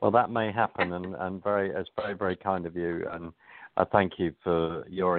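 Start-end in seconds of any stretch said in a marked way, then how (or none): none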